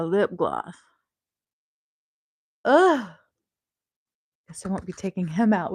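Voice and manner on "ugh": loud, high grossed-out ugh; a sharp groan of disgust. Real, not acted. Grossed out by cat hair on her lip gloss, loud ugh.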